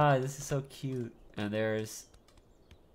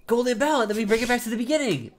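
excited; 9 semitones higher, 3 dB louder, faster than usual. Real, not acted. Excited gasp at prize.

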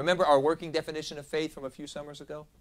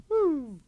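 surprised woo; 13 semitones higher, 5 dB louder than usual. Real, not acted. Reacting to fasting length.

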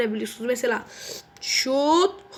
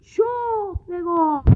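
mock commentator call; 4 semitones higher, much slower than usual. Gamer calling a goal.

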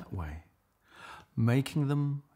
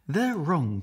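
defiant higher duck; the duck pushes back, pitched up; 8 semitones higher, 3 dB louder than usual. Acted. Duck defends Leonard against lions, higher defiant duck. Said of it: "quacked Marianne".

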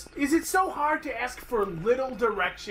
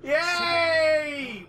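high, loud sarcastic yay; mock cheer; 8 semitones higher, 3 dB louder than usual. Real, not acted. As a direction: cheer it mockingly.

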